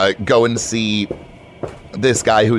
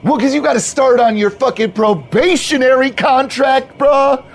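high, insistent bro pep; hyped-up bro; 3 dB louder than usual. Acted. Manager justifies probation to new hire, hyped-up bro. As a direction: explain it with pumped-up bro certainty.

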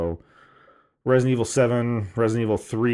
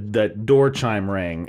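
normal talking voice

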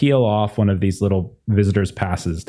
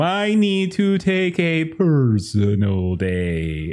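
slow, drawn-out flustered receptionist; flustered apology; 6 semitones higher, much slower than usual. Acted. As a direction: apologise while falling apart. Receptionist to principal.

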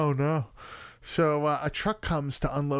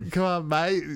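attempted Aussie, slightly louder; mock Australian accent; 4 semitones higher, 4 dB louder than usual. Shown as a demonstration. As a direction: try the Australian way. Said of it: "how do Australian people speak?".

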